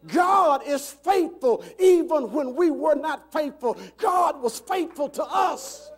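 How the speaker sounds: rousing sermon climax; full-force preaching. Real, not acted. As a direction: proclaim it to the congregation, high and forceful.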